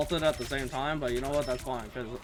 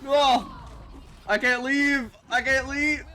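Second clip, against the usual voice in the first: loud, high panicked shouts; shouting; 9 semitones higher, 4 dB louder, slower than usual. Real, not acted. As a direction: yell it out in dismay. Trapped in vortex, cries out.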